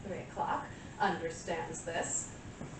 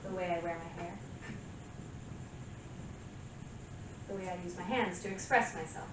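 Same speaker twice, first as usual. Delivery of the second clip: self-assured seduction; 7 dB quieter than usual. Acted. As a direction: play it softly seductive, savouring each pause. Monologue, flirting with listener she claims desires her.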